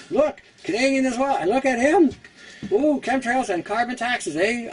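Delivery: high, goofy cartoon beaver with a Canadian "eh"; squeaky mock beaver poking fun at carbon taxes. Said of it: "He's like".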